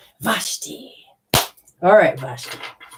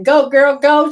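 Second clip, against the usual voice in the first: higher, louder cheer of encouragement; cheering mama; 6 semitones higher, 7 dB louder, slower than usual. Retold or imitated. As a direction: cheer her on like a proud mom. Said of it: "Your mama's like".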